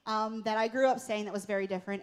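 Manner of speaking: normal talking voice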